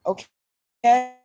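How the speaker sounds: ordinary delivery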